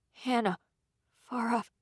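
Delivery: ordinary delivery, nothing marked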